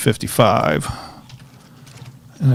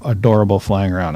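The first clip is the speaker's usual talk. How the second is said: normal talking voice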